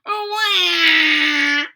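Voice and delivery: put-on loud high baby cry; baby wail. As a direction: wail like a newborn. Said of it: "Polly began to cry".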